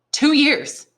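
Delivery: put-on young prisoner amazed at how quickly he can learn; astonished exclamation. A narrator's reading. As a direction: gasp out the words in disbelief. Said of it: "exclaimed Dantès".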